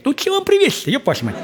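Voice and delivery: very high, mock-weary question; grumpy father. Father asks the daughters what to bring, high and weary. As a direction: ask like a dad sick of their requests. Father voiced asking again.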